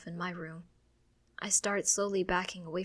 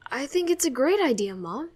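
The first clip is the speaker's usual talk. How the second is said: high, loud, eager assurance; swift reply; 6 semitones higher, 8 dB louder than usual. A narrator's reading. Child hiding fears from mom, swift eager reply. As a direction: jump in quickly to reassure. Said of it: "I swiftly reply".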